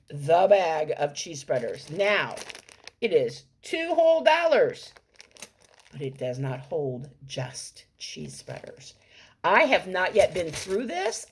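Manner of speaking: ordinary delivery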